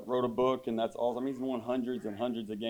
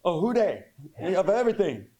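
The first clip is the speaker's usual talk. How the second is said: high, louder mock girlfriend voice; 8 semitones higher, 4 dB louder than usual. Comic imitating his Cajun ex-girlfriend, high and loud. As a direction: pitch way up to play the ex. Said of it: "She's like".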